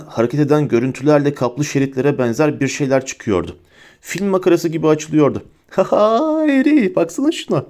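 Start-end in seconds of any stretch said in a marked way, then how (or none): 5.7-7.6 woozy high-pitched delight; giddy, drunken Ron. A narrator's reading. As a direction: show it off with silly glee. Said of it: "kıkırdayarak, sarhoş gibi yalpalayarak".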